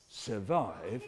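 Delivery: low, soft, weighty word; hushed emphasis. Acted. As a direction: drop right down after a pause. Dramatic pause on key word repeated.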